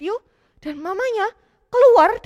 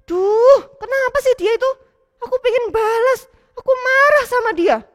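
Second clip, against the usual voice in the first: put-on mild kid anger; 8 semitones higher, 5 dB louder than usual.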